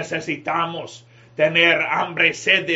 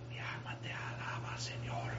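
barely audible, breath-only praise; mock whisper; 19 dB quieter than usual. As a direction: imitate a worshipper who only whispers to God. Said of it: "hablando bien bajo, hermanos". (susurro apenas audible, en tono de imitación)

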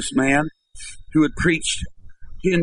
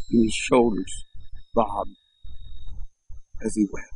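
hushed narration; 6 semitones lower, 6 dB quieter than usual. A narrator's reading. Preacher telling of a man weeping, hushed. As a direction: let the telling sink into a hush.

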